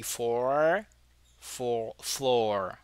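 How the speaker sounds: four and floor drawn out to show the r; R demonstration. Shown as a demonstration. Teacher pointing out two R's, isolated word demonstration. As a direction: say four, four, floor one by one, dwelling on the endings. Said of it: "você tem dois R's aí".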